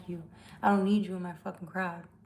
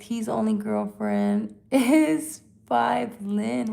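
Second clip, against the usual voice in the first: slow, laughing through the words; reading a comment through laughter; 2 semitones higher, 6 dB louder, much slower than usual. Real, not acted. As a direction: read it while cracking up.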